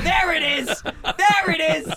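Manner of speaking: high laughing crow of triumph; laughing gotcha. Real, not acted. Cohost finally admits he wasn't listening, laughing crow. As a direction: laugh it out, caught him.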